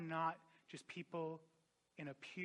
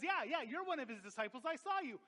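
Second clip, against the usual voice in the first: high-pitched, insistent accusation; excited higher-voiced accuser; accusing slave girl; 8 semitones higher than usual. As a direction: act out a girl pointing the finger. Acted slave girl accusing Peter.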